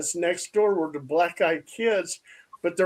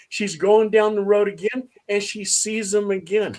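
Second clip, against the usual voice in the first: ordinary talk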